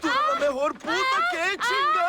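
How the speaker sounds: high, fast cry mid-lovemaking; heated cry of passion. Acted. Rebel leader in bed with his lover, crying out in pleasure. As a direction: blurt it out breathless with pleasure.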